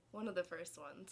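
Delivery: usual speaking voice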